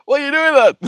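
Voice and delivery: high loud panicked "what are you doing"; startled outrage. Acted. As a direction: yelp in shocked alarm, fast at the end. Acted startled, defensive quarrel.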